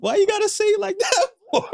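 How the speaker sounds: very high, squeaky complaint; mock-wounded protest. Husband protesting to his teasing wife, mock-hurt squeal. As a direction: whine it in a comic falsetto.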